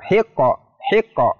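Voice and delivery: "hiqqa" with the q bounced, like hi-ko; qalqalah bounce. Shown as a demonstration. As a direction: release the q of "hiqqa" into a short echo. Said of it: "ada pantulannya ya".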